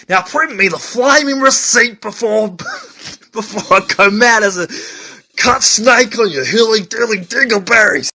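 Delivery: hammed-up angry outburst, pitched high; exasperated rant. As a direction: build to a high, loud comic tirade. Acted rant at a hippie customer.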